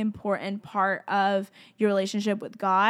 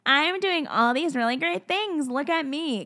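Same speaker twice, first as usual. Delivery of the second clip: lightly hammed-up show-off, pitched higher; mock boasting; 5 semitones higher than usual. Speaker mimicking someone showing off to listeners, mock boasting. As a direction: playfully pretend to brag. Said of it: "am I saying this to be like".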